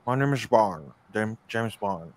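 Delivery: slow, lightly voiced film spy introduction; a hint of an old screen star, drawn out; Sean Connery. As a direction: introduce yourself slowly, trailing softly on the name. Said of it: "but he'll be like".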